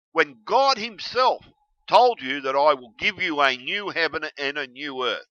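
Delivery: ordinary delivery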